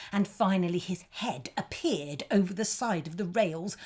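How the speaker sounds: ordinary delivery, nothing marked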